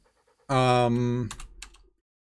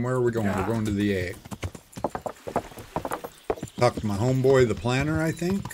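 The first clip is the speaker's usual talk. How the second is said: ordinary talk, nothing marked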